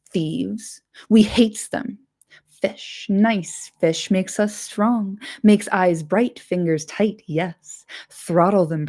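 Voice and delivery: greedy, muttering Gollum swinging between glee and spite; a wretched creature crooning over fish, then snarling; Gollum talking to himself. Acted. Gollum's voice held.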